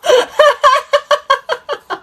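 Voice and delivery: long high giggle; high laugh. Real, not acted. Amused at her own claim, long high giggle. Laugh after naming extraterrestrials.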